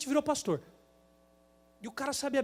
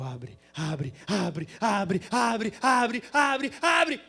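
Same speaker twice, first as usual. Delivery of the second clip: pleading with the light; 5 dB louder, much slower than usual. Retold or imitated. Driver pleading with the red traffic light, building to a shout. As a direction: urge the light to open, louder each time. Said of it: "E eu olhando pro semáforo".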